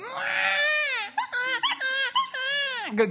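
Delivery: put-on long, very high-pitched baby wail; practiced imitation of a wailing baby; imitated baby wail. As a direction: mimic a baby's piercing cry after a fall. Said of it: "that's been practiced".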